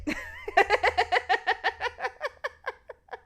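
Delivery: long high fit of laughter; real giggling. Real, not acted. Storyteller cracking up before her line, real giggling. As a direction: dissolve into helpless high giggles.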